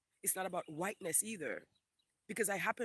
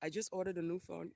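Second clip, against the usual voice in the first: ordinary talk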